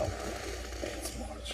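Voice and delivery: soft whisper, barely there; library voice. Real, not acted. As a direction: hush it like in a library. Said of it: "like library voices".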